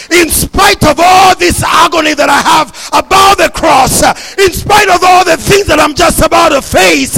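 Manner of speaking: loud, fervent Jesus voice; Jesus declaring he feels the faith; preaching at full force. Acted. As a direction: proclaim it with everything you have.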